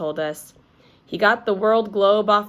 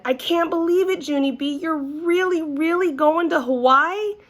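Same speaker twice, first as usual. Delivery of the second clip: high, wide-eyed disbelief; can't believe it, pitch way up; amazed; 10 semitones higher than usual. A narrator's reading. Shirley gasps at Junie B's trip to Hawaii, amazed.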